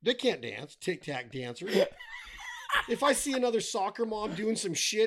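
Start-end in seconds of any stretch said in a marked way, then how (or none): 1.8-2.9 high shouted laugh. Real, not acted. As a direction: burst out laughing. Big laugh ending rant.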